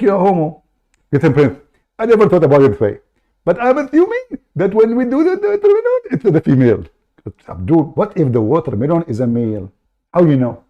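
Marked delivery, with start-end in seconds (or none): 1.1-6.8 raised, pushy put-on caller voice; fake caller voice. Prank caller cutting into the host's show, high put-on voice. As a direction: barge in as an eager fake caller.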